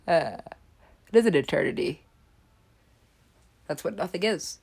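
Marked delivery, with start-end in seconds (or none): none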